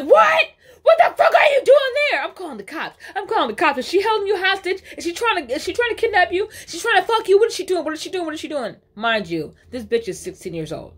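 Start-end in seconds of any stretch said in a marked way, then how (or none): 0.0-2.2 high, loud disbelieving yell broken by laughter; hammed-up shocked mother yelling; mock outraged shout. Mom on phone, retold outburst. 2.2-8.8 high, rushed panicky questions; mock hysterical mother spiraling; imitating a frantic mom. Mom on phone, frantic accusations.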